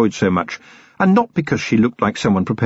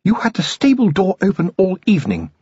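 hissed; 10 semitones higher than usual. A narrator's reading. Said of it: "a hissed".

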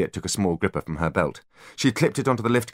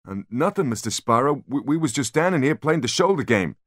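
higher, dopey henchman voice; dim-witted thug; 8 semitones higher than usual. A narrator's reading. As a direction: answer like a clueless goon. Dim bodyguards answer.

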